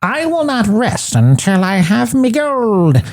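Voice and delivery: high, slow leprechaun voice. Retold or imitated. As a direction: growl out the curse like a leprechaun. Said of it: "we hear the leprechaun's voice".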